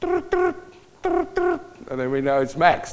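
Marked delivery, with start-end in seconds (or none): 0.0-1.6 high trills with no pause; parrot's rushed ring. Retold or imitated. Parrot failing to copy the phone, rushed trills. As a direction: trill quickly without the gap. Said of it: "He goes".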